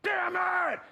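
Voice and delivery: high-pitched cry of exasperation; frustrated outburst. Retold or imitated. Actor reliving a flubbed line, frustrated outburst. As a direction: blow up at your own mistake. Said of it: "my mind snapped".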